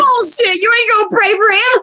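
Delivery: high and loud, calling his bluff; mock outraged callout. Real, not acted. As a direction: shout him down in disbelief. Teasing friend calling out bullshit.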